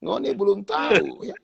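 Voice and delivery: Ngoni belum tahu ya in Manado dialect; Manado accent. Shown as a demonstration. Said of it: "logat Manado".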